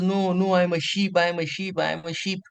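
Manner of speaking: high, insistent repeated denial; fearful insistence. Acted. Cub denying to the lion he's one, acted fearful insistence. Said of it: "he's so fearful".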